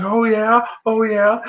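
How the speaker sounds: high-pitched mock challenge; retold challenge. Wrestler challenging a heckling fan, acted-out taunt. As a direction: imitate squaring up to a heckler.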